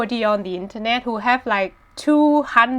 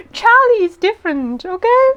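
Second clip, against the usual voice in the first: higher, louder sassy retort; defensive, sassy; 10 semitones higher, 7 dB louder than usual. Real, not acted. Retorts playfully to a comment.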